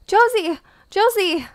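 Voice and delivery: high loud call. Real, not acted. Worried player yelling down to Josie. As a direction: yell a friend's name down a pit. Calling down to fallen friend.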